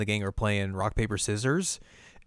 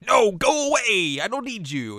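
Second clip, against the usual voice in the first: petulant retold rejection; 10 semitones higher, 3 dB louder than usual. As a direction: shoo away a helper in a hammed-up sulk. Retold villain rejects offered help.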